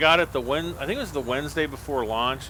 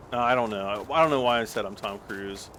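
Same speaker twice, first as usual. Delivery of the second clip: ordinary talk, nothing marked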